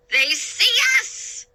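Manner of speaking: high, slow, excited cry. A narrator's reading. Sailor waving at the ship, excited cry. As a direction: shout the good news. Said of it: "Salty cried".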